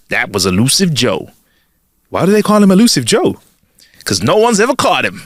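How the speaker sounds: put-on amateur deep South accent for a joke. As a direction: play it as a deep South saloon exchange. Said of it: "deep South accent".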